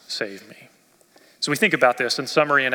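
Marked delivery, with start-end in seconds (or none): 0.1-0.7 much softer, a plea at the very end; desperate soft plea. Retold or imitated. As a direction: beg quietly, out of strength. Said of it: "He's so desperate".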